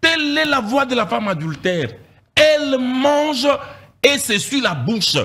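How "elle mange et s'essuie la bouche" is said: slow emphatic. Shown as a demonstration. Preacher dissecting the verse for the congregation, slow and emphatic. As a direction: lean on "Elle mange", slow and raised. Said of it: "Je décortique".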